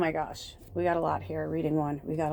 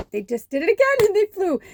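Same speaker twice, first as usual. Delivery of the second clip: higher, louder, thrilled; real excitement; 12 semitones higher, 6 dB louder than usual. Real, not acted. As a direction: burst out as the birds fly off. Excited about the birds.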